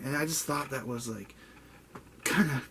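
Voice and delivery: ordinary delivery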